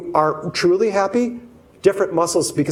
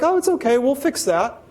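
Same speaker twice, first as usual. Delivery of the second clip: reassuring boss; 7 semitones higher than usual. Retold or imitated. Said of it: "their leaders were like".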